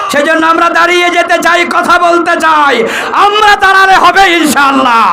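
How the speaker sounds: high-pitched full-force exhortation; rallying sermon cry. Real, not acted. Preacher calls crowd to stand for Islam, rallying cry. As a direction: rouse the crowd to answer.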